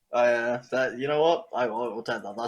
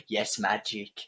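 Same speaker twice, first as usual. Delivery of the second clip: drawn-out dreamy magic voice; mystical voice; 3 dB quieter, slower than usual. Acted. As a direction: say it like casting a spell. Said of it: "make a rainbow and go".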